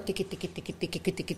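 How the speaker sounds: soft tapping imitation; quick soft clicks; typing noise. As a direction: mimic fingers on a phone.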